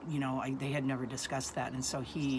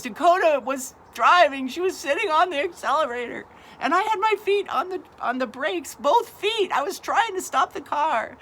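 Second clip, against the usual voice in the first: high, loud, distraught protest; imitating a teenager's hysterical explanation; frantic son; 13 semitones higher, 10 dB louder than usual. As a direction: shout your excuse in a panic.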